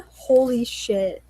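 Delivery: usual speaking voice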